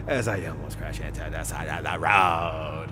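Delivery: mock-dramatic low delivery; hammed-up low voice. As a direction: drop way down and stretch the last word. Exaggerated retake of the line.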